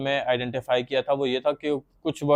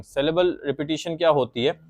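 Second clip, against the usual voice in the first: normal talking voice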